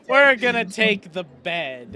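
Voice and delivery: put-on slow, slurred drunken voice. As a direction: talk like a drunk holding rats. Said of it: "and he's like".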